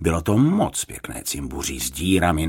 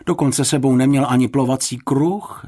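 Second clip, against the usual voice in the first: higher, fretful worry; worried; 7 semitones higher, 4 dB louder than usual. A narrator's reading. Mrs Fousková fretting about the missing one, worried. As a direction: voice a mother's mounting worry. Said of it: "strachovala se paní Fousková".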